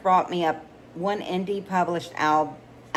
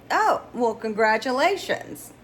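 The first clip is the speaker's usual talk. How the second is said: awkward surprise; 6 semitones higher, 4 dB louder than usual. Acted. Surprised reply to friend's confession.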